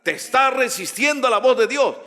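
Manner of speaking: high, forceful rebuke; prophet's authority. Shown as a demonstration. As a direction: confront them with a prophet's authority. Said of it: "Habla con autoridad".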